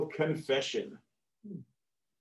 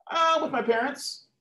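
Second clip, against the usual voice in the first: put-on high-pitched teenager's reply; 11 semitones higher than usual. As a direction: answer the cop in a high teen voice. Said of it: "dialogue between the police and the teenager".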